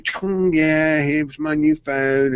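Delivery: lightly imitated swanky boast; swanking show-off. Retelling wife showing her phone to friends, swanking. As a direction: show it off with mock swagger. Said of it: "swanning round at the bank, swanking it off".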